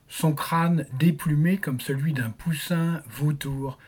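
ordinary delivery, nothing marked

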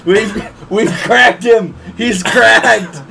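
loud, slow, gleeful call; excited laughing shout. Real, not acted. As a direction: crow it out in triumph. Friends laughing, triumphant call.

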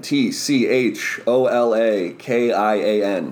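slow spelling. Shown as a demonstration. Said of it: "that name is Cholakian".

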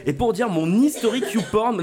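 laughing through the words; giggling while talking. Real, not acted. Embarrassed joke, laughing.

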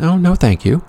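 a hint of a dainty refusal; polite woman voiced.

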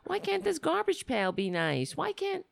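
high whiny mock designer voice; shrill-high singsong parody of the decorator. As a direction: squeal it like a gushing designer who wants everything nice. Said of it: "'Cause she was like".

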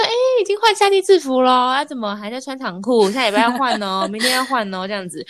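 high, singsong teacher scolding gently; imitating teacher. Retelling how the teacher nagged her, high mock-teacher voice. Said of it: "他就说，他说".

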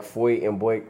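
"foit" and "boik" with an oi vowel in place of the i; Philly vowel. Shown as a demonstration. Reading out the Philly oi for fight and bike. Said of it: "sound more like foit and boik".

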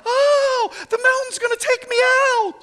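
very high, squealing wail; hammed-up terrified squeal; mock panic. Preacher mocking people scared of the mountain, panicky squeal. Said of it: "or you're like".

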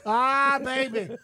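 high, hammed-up exclamation; mock outburst. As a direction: burst out in playful mock excitement. Mock outburst after Big Sugar joke.

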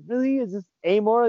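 high, incredulous "Really?"; mock disbelief. Server asking coworker if this is wrong, incredulous. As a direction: sound shocked at the ethics. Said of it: "I was like".

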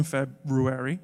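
"February" split as "Feb-ROO-ary"; "February" with a clearly sounded "ROO"; correct pronunciation. Shown as a demonstration. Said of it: "trying to keep the Ru alive".

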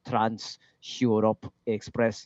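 put-on very slow, accented robotic delivery; [name]. Musician showing how an old electronic band speaks, slow robotic accent. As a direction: imitate stilted European-accented vocoder speech, word by word. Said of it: "the way they speak".

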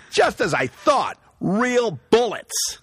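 high, slow, hammed-up proclamation; laughing imitation of a startled movie hero; mock dramatic discovery. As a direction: draw it out with theatrical alarm. Acts out actor finding real bullets.

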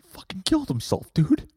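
high, strained put-on voice; distraught friend. Friend telling of brother's death, high and upset. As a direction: break the news in a high voice. Acted friend breaking bad news.

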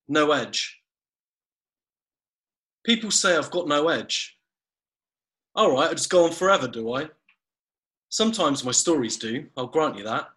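measured poetry recital; poem reading. Acted. Host reciting his comic poem to the co-host, poetry voice. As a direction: read each couplet with a pause. Reads second short poem.

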